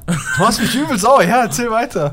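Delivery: high, loud mock fury; mock outrage. Real, not acted. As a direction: pretend to be furious. Friend outraged, laughing.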